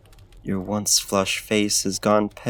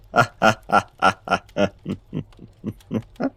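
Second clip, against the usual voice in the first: mocking drawn-out laugh; 3 dB louder than usual. Laughing off her plea to leave.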